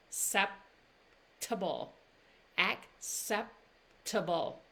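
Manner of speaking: acceptable drawn out slowly, ak-SEP-tuh-bul; slow demo. Shown as a demonstration. Teacher slowing down acceptable for learners. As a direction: stretch out acceptable. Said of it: "See the difference?".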